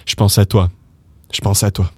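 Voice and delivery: "Je pense à toi" as "shponce-a-twa"; casual run-together "shponce-a-twa"; slang contraction. Shown as a demonstration. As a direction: merge "Je" into "pense" with a "sh". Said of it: "same thing and say".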